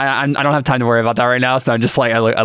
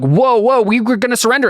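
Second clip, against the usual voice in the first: high-pitched, louder mock protest; alarmed protest; 12 semitones higher, 3 dB louder than usual. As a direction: blurt it high, halting a shooter. Acts out enemies protesting their shooting.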